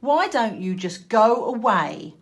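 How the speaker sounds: loud, harsh squawk of mean ducklings. A narrator's reading. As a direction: squawk it at the outsider. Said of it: "squawked the other little ducklings".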